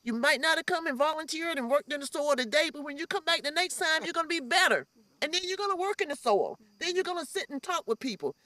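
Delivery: reassuring encouragement. Retold or imitated. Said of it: "And I say to them".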